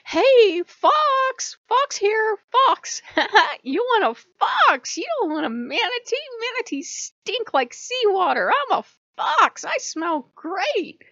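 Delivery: very high, loud show-off fox voice; obnoxious fox. Retold or imitated. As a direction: yap it out like a pushy cartoon fox hogging the spotlight. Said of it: "Some totems, like Fox, are obnoxious".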